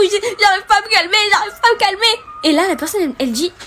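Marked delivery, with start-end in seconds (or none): 0.4-2.2 retold sobbing. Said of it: "pleurer énormément".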